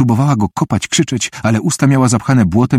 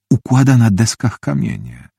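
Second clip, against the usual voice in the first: usual speaking voice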